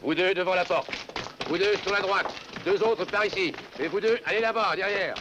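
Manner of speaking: barked orders. Acted. Officer posting guards.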